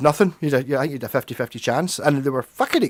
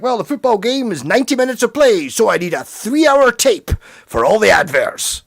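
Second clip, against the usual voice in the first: mock American; 6 semitones higher, 3 dB louder than usual. Mocking Americans wanting long tapes.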